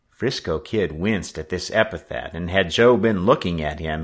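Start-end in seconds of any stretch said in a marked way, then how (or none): none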